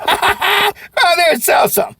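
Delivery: high, loud while laughing; cheerful laughing goodbye; laughing sign-off. Real, not acted. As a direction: laugh through the send-off. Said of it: "Do me a favor".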